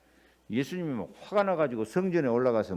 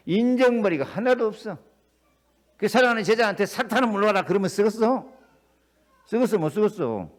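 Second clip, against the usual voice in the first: higher, louder scandalised complaint; mock indignation; 5 semitones higher, 5 dB louder than usual. Preacher voicing critics who find Jesus heartless, indignant. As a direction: play the outraged critic.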